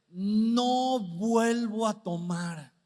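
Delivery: muy lento y fuerte, arrastrando las palabras; promesa de borracho. Retold or imitated. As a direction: imita al crudo que jura no volver a tomar. Borracho promete con la cruda. (el borracho crudo jura no tomar más, arrastrando las palabras)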